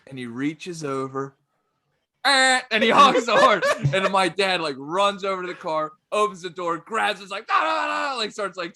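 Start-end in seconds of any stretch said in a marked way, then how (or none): 2.2-2.6 loud, high honk noise; vocal horn blast; imitated car horn. 7.5-8.2 high repeated imitation scream; retold screaming, pitched way up; mock scream.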